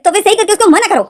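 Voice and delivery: retelling his angry demand with force; Hindi: गुस्से में ऊँची आवाज़ में हुक्म; angry order. Retold or imitated. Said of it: "wing जैसे गुस्से में हो जाता है".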